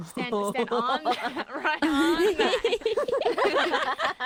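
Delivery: laughing coax. Pretend. Coaxing partner onto the pad.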